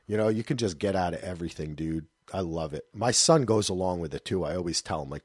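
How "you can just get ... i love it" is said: ordinary talk, nothing marked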